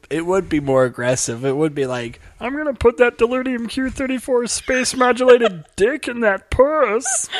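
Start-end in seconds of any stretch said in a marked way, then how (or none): 2.4-7.3 high cartoon alien voice, slowing at end; leering little Martian; Marvin the Martian. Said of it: "Marvin the Martian impression".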